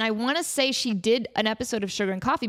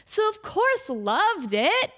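showy announcer call; 11 semitones higher, slower than usual. Retold or imitated. Quoting the Amanda Show bit.

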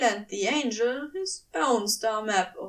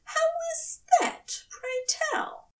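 high, arch, annoyed query; prim sarcastic challenge; annoyed angel. A narrator's reading. Aziraphale challenges Crowley, high and arch. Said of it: "arched an annoyed eyebrow".

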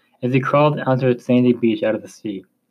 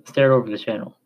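usual speaking voice

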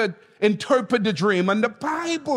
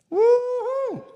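excited whoop; 14 semitones higher than usual. Real, not acted. Preacher whoops to congregation.

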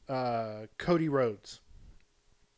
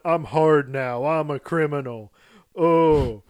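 louder mock tough-guy boast; mocking Dom tough talk; 7 dB louder than usual. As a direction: puff up and brag like a fake hard man. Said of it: "him going off going".

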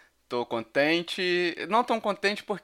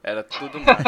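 laughing; 5 dB louder than usual. Real, not acted. Echoing with a laugh.

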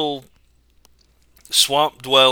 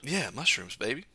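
whispering; 9 dB quieter than usual. Acted. Whispered reply.

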